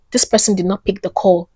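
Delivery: ordinary delivery, nothing marked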